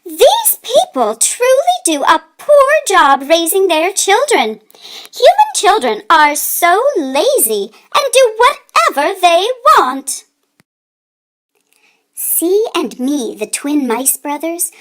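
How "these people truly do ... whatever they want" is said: higher, huffy mouse mother; scandalized Mama Mouse. Acted. Mama Mouse complaining about lazy human children, scandalized. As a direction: tut like a shocked little mouse mother. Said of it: "Mama Mouse was scandalized".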